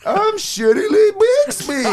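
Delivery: very high loud falsetto lady voice; co-host in drag as Mrs Doubtfire, screeching his entrance. Retold or imitated. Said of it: "He keeps mispronouncing".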